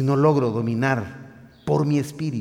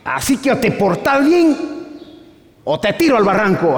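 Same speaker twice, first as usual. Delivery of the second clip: stern mother; 10 semitones higher than usual. Retold or imitated. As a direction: scold him like an angry mom. Mother threatening her son.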